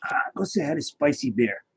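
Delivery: soft breathy whisper to the pet; gentle whispered nudge to the dog. Real, not acted. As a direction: hush it so the room stays quiet.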